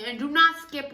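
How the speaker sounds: loud, playful warning; mock threat. Real, not acted. Friend playfully warning viewers not to skip. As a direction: bark it at the viewers in fun. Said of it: "That sounded too violent".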